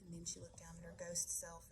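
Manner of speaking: ordinary delivery, nothing marked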